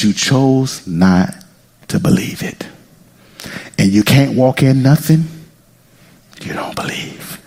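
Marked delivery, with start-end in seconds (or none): 1.9-2.7 whisper. Acted. Dramatic drop in sermon. 6.4-7.4 breathy whispered close. Acted. Dramatic drop in sermon.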